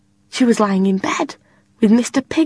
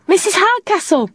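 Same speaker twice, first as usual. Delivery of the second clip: far away call; 9 semitones higher than usual. Acted. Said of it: "far away, as if it was in a dream".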